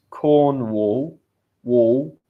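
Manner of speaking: "Cornwall" drawn out, then "wall" alone, loud; loud, careful "Cornwall", repeating the end "wall"; pronunciation demo. Shown as a demonstration. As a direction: model "Cornwall" for learners, then isolate "wall". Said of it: "it's a little bit hard to pronounce".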